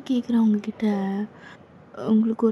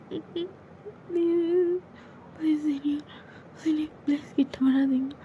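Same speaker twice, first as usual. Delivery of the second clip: breathy pleading whisper, higher; frightened girl whispering; 4 semitones higher, 5 dB quieter than usual. Acted. Junior whispering to her senior to keep away, frightened. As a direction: beg him to stay away, barely voiced.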